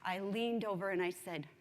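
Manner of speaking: ordinary delivery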